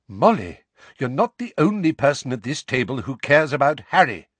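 sharp reprimand to a friend. A narrator's reading. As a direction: cut in sharply and firmly. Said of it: "said Lupin sharply".

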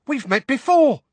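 high, loud excitable squeak. A narrator's reading. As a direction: squeak it out, all flustered. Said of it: "squeaked the excitable Diggle".

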